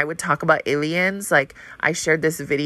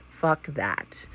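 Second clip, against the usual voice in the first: whisper; 7 dB quieter than usual. Acted. Repeats the rejection as a whisper.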